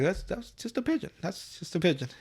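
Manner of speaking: dismissive cop. Retold or imitated. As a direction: shrug it off quietly. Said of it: "Barber is like".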